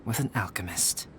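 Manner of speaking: hushed whisper to open the tale. Acted. Albedo beginning his eerie tale to Paimon, whispered. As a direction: lean in and whisper the first line. Ominous start of a story.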